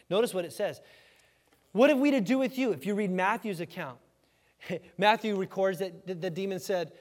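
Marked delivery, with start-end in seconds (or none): 1.7-2.7 scared protest. Acted. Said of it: "They're scared to death of him".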